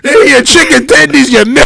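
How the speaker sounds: mock waiter broken by laughs; laughing mock waiter. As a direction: repeat the waiter bit through laughter. Repeats the mock waiter line laughing.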